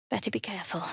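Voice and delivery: soft, wary whisper. Acted. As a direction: whisper a warning. Watched by scrying eye.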